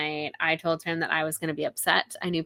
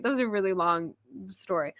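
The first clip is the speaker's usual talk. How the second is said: ordinary delivery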